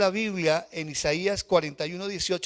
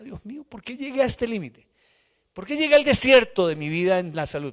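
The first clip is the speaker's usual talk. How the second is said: a raised, pained cry to God rising in volume; anguished outcry; 6 semitones higher, 8 dB louder than usual. Retold or imitated. Preacher reliving his cry to God, raised and pained. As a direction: cry out to God from the bottom of the desert. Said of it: "yo lloraba y decía".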